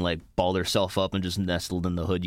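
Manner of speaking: normal talking voice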